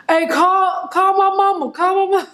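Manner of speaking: high, loud whiny plea for mama; whiny high cry from the cop car; mock pleading. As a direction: wail it like a scared kid.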